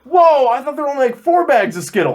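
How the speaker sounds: high, loud whoa of surprise; amazed. Real, not acted. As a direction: genuine wonder at the news. Amazed at many colors.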